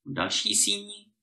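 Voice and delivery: plain reading voice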